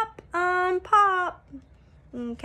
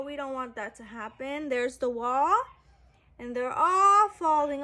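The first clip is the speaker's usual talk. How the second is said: plain reading voice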